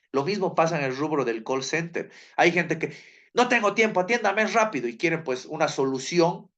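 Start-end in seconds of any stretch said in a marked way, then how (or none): 3.3-4.9 high-pitched mock customer in a rush; raised-pitch, impatient caller impression. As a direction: imitate a hurried caller demanding service, pitched up. Voicing an impatient call-center customer.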